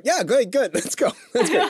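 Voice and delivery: mock her instant delighted agreement with a laugh; eager yes. Host imitating the guest's instant yes to a Harvard debate, eager and high. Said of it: "But you're like".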